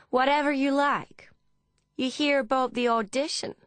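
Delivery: high, loud Texan woman; Texan accent. A narrator's reading. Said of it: "in an accent similar to Deborah's Texan friend".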